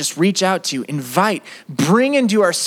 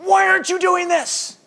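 sharp, high, demanding question to God; mock-angry prayer; imitating an angry prayer, pitched high; 14 semitones higher than usual. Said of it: "the prayers become angry".

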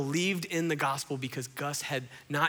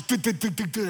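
rapid stuttered heartbeat imitation; staccato "du-du-du" thumps; pounding heartbeat noise; 5 semitones higher, 6 dB louder than usual. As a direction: mimic a racing heart in quick pulses.

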